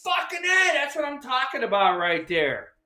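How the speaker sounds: retold triumph; triumphant cheer. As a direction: celebrate the win. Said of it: "I'm like".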